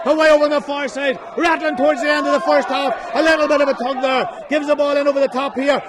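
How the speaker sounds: high, loud rapid live call; excited play-by-play. Real, not acted. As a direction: ride every attack loudly. Frantic play-by-play to point.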